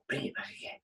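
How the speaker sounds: breath only, barely voiced; hushed repeat. Shown as a demonstration. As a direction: let it drop to a soft whisper. Phrase repeated, second time hushed.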